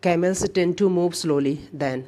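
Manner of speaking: very slow reading of the question. Shown as a demonstration. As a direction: read out the sentence clearly. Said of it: "कह रहा है कि". (बहुत धीरे पढ़ना)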